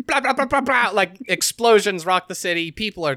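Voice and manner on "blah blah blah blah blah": high, rapid-fire blah noises; rapid mock battle racket; mimicked gunfire. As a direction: rattle off explosion noises by mouth.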